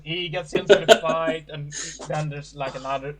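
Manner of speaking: usual speaking voice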